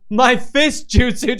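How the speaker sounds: loud, high, drawn-out mock anime attack call; mock battle cry. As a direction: stretch it out like a hammed-up jutsu shout. Mimics George yelling mock jutsu.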